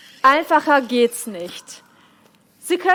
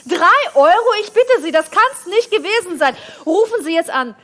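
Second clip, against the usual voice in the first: infomercial; 5 semitones higher than usual. Acted. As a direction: push hard like a TV shopping host. Hyped teleshopping pitch.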